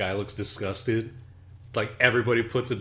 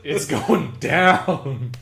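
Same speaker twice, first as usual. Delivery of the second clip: mock alarm; 6 semitones higher, 6 dB louder, much slower than usual. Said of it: "I'm like".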